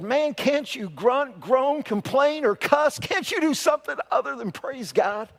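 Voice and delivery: high, slow, exasperated plea. Acted. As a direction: beg him to complain for once. Exasperated doctor pleads.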